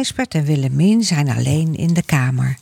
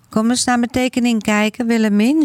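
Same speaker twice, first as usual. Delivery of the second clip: put-on high, inviting child voice; young boy's voice; 7 semitones higher than usual. A narrator's reading. Boy showing his sister his drawing, high inviting voice.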